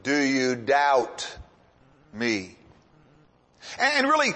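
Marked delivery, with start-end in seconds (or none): 0.0-2.6 Jesus questioning. Retold or imitated. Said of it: "And Jesus says".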